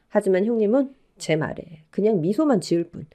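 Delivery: plain reading voice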